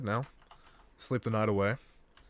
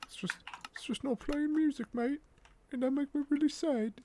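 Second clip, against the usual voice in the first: much higher, put-on whiny voice; mock whining. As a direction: whine like a sulky kid about the music. Mock whining about missing music.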